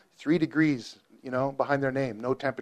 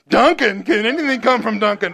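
teasing scoff; 6 semitones higher, 5 dB louder than usual. Retold or imitated. Teasing Nathan about Duncan.

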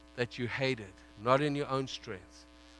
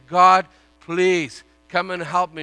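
pleading prayer; 4 semitones higher, 11 dB louder, slower than usual. Acted. Voiced prayer inside the sermon.

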